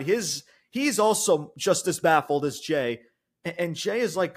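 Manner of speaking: ordinary talk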